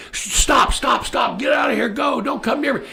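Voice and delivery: urgent, rapid warning cries in a high pitch; retold yelling. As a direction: imitate a man yelling someone away. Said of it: "he's yelling at him".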